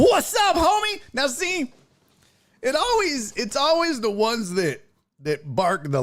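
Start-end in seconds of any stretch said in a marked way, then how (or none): 0.0-1.0 high, loud tough-guy "What's up, homie?"; mock tough guy. Reacting to a road rage clip, mimics the tough guy's hollow greeting. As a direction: puff up like a fake tough guy.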